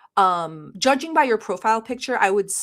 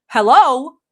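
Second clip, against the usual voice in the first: loud, incredulous yell; exasperated yell; 4 semitones higher, 11 dB louder than usual. Acted. Streamer scolding a viewer over a silly suggestion, exasperated yell. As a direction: yell it in disbelief. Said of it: "sorry to yell at you guys".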